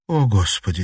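ordinary delivery

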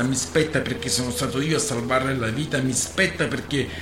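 a sailor arguing his rights before the crew; defiant oration. Acted. Rogerio to the captain, loud defiant speech.

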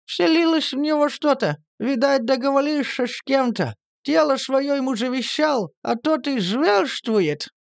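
put-on high, mumbled old crone voice; high toothless mumble; mumbling old woman. A narrator's reading. Nastya's old-woman voice continues.

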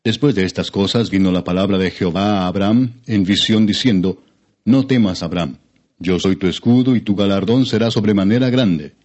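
plain reading voice

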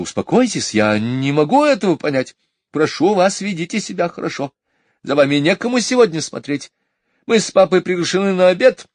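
high, hurried, loud pleading; rushing off to a dinner; high fast fussing mother; flustered mother. A narrator's reading.

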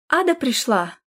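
understated cry of a younger brother. A narrator's reading. Bedridden little brother greets his sister, crying out mildly. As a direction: call out lightly to announce her. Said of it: "крикнул младший брат".

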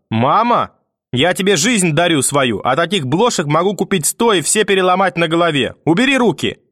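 high, indignant tirade rising to a cry; furious grandmother. A narrator's reading. Grandmother about to destroy mother's gift, furious. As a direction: thunder your grievance at the child.

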